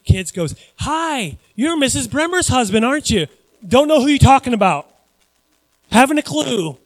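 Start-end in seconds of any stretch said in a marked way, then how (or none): 0.8-3.3 put-on high, chirpy child's greeting; little kid. Kid at the grocery store to preacher, high-pitched. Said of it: "some little kid goes". 3.7-6.8 loud, raised brush-off; exaggerated pretend ignorance; mock denial. Preacher pretending to deny the kid, loud hammed-up denial. Acts denying the kid's question.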